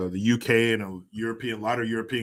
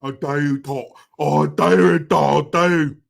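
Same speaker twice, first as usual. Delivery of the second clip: hammed-up posh English accent, getting louder; mock posh English; 7 dB louder, much slower than usual. Streamer teasing Tracy about her accent, mock posh English. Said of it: "Are you more posh?".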